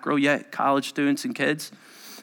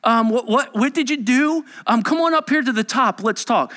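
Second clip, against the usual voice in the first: higher, hesitant small-talk voice; mock-casual chat, pitched up; mock casual questioning; 12 semitones higher, 5 dB louder than usual. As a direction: imitate a polite, halting interviewer. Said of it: "just in case you thought this is how the story goes".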